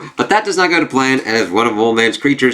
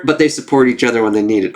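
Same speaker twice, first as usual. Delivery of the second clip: normal talking voice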